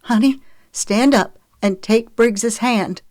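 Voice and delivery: high, slow, panicked encouragement; laced with panic. A narrator's reading. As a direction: coax your child while fighting panic. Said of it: "her voice laced with panic".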